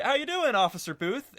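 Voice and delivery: high, bright sucking-up greeting; suddenly friendly. Acted. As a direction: switch to fawning over the officer. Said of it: "his demeanor completely changes".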